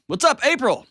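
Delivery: high, bright West Texas greeting; West Texas accent. Acted. Host greeting caller April in a West Texas accent. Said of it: "West Texas accent in Fort Wayne".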